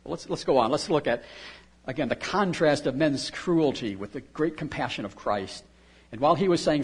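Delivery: normal talking voice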